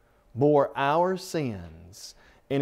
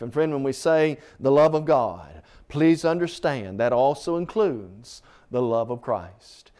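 normal talking voice

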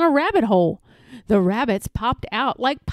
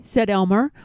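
usual speaking voice

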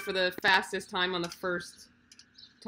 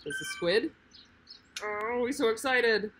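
pet talk; 6 semitones higher than usual. Real, not acted. Cooing to cat on lap.

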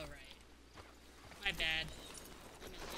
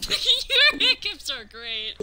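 laughing through the words; giggling while talking; 20 dB louder, slower than usual. Real, not acted. Laughing at friend's hiccups.

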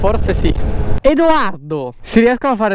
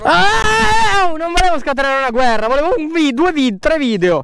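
high squealing protest; 8 semitones higher than usual. Real, not acted. Squealing while tickled.

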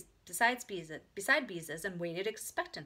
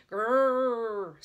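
loud, drawn-out engine growl; bulldozer growl; 3 semitones higher, 9 dB louder than usual. A narrator's reading. Storybook shovel imitates bulldozer, growling. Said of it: "to sound like a bulldozer".

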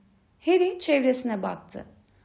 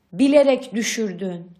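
louder, accusing narrated roar; contained roar, storyteller's scale; angry roar; 3 semitones lower, 5 dB louder than usual. A narrator's reading. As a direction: come down hard on the accusation. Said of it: "diye kükredi Mister Weasley".

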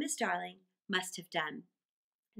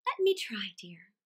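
placid. A narrator's reading. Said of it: "Missus Darling was placid".

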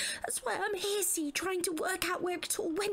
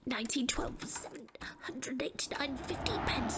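whisper; 5 semitones lower, 5 dB quieter than usual. Acted. Muttering over accounts.

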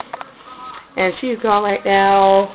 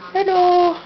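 much higher cooing hello; high pet greeting; 11 semitones higher than usual. Real, not acted. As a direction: sing hello up to the cat. Greeting her cat.

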